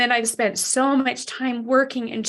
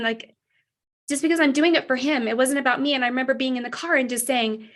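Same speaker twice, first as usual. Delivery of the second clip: ordinary talk, nothing marked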